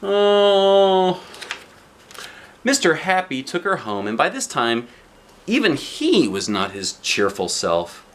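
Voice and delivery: usual speaking voice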